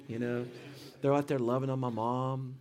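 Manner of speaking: usual speaking voice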